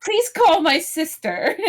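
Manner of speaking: high, slow, laughing grand lady; imperious plummy grande dame. Pretend. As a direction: order the servant with a giggle. Ordering the butler.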